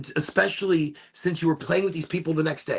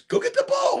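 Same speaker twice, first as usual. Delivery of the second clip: much higher, louder retold yell; kid yelling; 4 dB louder than usual. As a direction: bark the order across the street. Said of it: "He goes".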